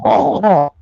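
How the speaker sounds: hammed-up French "oh", pitched high; French accent. Shown as a demonstration. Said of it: "you know, French".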